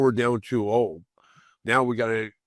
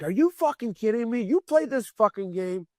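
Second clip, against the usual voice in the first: high, raised angry challenge; furious disbelief; 10 semitones higher than usual. Retold or imitated. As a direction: demand an answer, outraged. Confronting coach, furious.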